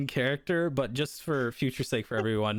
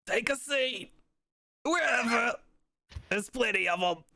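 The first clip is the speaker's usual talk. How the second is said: high NPC voice; 12 semitones higher than usual. Acted. DM's NPC waves the newcomer to any seat in a high voice. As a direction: voice pitched well up, casual and offhand.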